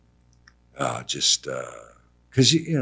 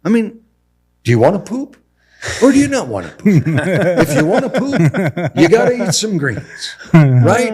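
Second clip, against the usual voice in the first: louder, higher exasperated lecture, half laughing; 8 semitones higher, 9 dB louder than usual. Retold or imitated. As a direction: plead with mom about greens, cracking up. Exasperated son lectures mother.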